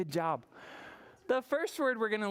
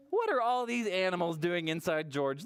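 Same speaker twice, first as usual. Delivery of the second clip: usual speaking voice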